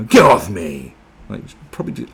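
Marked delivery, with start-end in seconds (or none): 0.0-0.9 loud acted outburst; crazy woman's shout. Imitating a woman acting crazy, hammed-up shout. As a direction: yell it as a mock crazy woman. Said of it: "just being a bit crazy".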